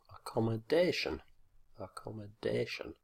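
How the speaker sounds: accommodation with the fourth syllable, DA, stressed; stress on DAY. Shown as a demonstration. Teacher giving the answers, accommodation stressed on DA. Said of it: "these are the answers".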